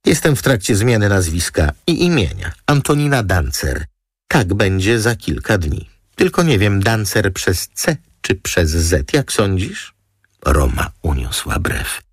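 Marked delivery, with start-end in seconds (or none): 0.1-9.9 higher, breezy model voice; chatty glamorous model. Acted. Model chatting to the lawyer about her new name, high and breezy.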